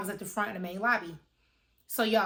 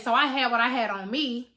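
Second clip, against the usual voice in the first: normal talking voice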